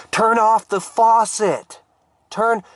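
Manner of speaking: high-pitched, slow urgent call; urgent shouted order. Acted. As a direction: shout a warning across a flooded room. Shouting advice at frantic mopper.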